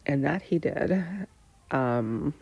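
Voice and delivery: ordinary talk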